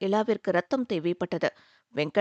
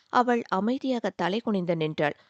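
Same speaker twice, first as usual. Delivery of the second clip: usual speaking voice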